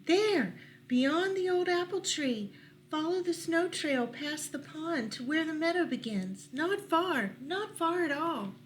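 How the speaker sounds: high, light dove voice; dove giving directions. Acted. Mourning dove pointing the way past the pond, high voice. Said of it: "dove directs the animals".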